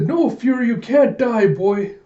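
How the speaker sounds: acted anguished cry; distraught plea. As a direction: beg a wounded pet to live. Koyomi pleads with wounded Fury.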